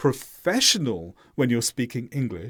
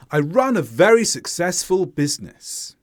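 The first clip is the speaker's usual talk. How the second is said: certain, tone dropping step by step to BUSINESS; falling intonation; 3 dB louder than usual. Shown as a demonstration. Teacher repeating the model for learners, falling to BUSINESS. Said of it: "See how the tone falls".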